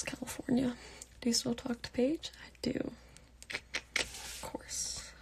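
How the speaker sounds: hushed, near-whispered answers; soft whisper. Real, not acted. Whispering replies to fans so her sister can sleep. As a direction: keep it barely voiced so the sister sleeps. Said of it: "I love how you're talking. It's so soft".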